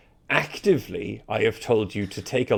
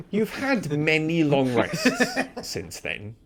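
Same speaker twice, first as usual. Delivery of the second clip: laughing; 8 semitones higher, 8 dB louder than usual. Real, not acted. GM laughing at player.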